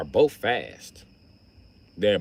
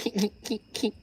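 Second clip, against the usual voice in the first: high laugh; 11 semitones higher, 6 dB quieter than usual. Retold or imitated. Laughing at the roast.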